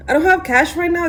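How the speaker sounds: anxious high plea to the officers; panicked protest. Retold or imitated. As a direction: blurt it out high and rattled. Pleading with border officers.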